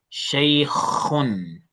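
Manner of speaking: Shaykhun with a throaty kh from the throat; model pronunciation. Shown as a demonstration. Teacher models kha for a struggling student, throaty kh. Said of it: "That sound that comes out".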